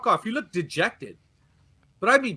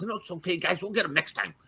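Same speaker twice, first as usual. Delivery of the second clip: mock happy clapper; 2 semitones higher, 5 dB quieter than usual. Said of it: "I try to be Captain Happy Clapper".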